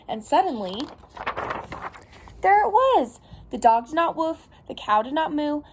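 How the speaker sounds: animated picture-book reading, lilting pitch; storytime read-aloud. Acted. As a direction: read it to little children, lively and clear. Reads picture book to children.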